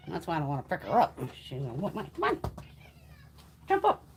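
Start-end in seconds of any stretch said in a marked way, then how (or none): 2.2-4.1 much higher coaxing call; high coaxing call to the cat. Real, not acted. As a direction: lure the cat onto your lap.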